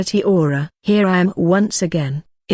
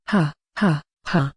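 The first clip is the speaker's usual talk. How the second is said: gleeful sly laugh; 2 semitones lower, 3 dB quieter than usual. Acted. Narrator relishes the elf's coming fright.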